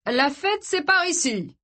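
high, eager party welcome; a lively welcome; excited host. Acted. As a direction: show them the way with glee.

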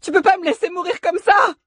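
high-pitched, loud pleading yell; desperate scream. A narrator's reading. Woman pounding on a locked door in a fire, screaming desperately. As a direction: scream it as a plea for your life. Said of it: "hurla Eléa en tambourinant à la porte".

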